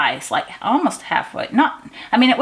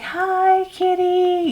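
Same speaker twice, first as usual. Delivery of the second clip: sweet cat greeting; 11 semitones higher, much slower than usual. Retold or imitated. Calling sweetly to the stray cat.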